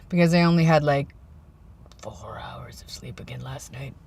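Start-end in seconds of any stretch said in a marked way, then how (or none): 2.0-3.9 whispering. Real, not acted. Unvoiced, short of sleep.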